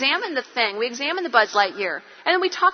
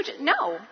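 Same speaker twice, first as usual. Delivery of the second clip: soft "Just, no." with a laugh; a quiet, final "no"; gentle firm no; 4 dB quieter than usual. Shown as a demonstration. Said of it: "Do that one".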